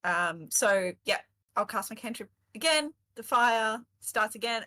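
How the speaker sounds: normal talking voice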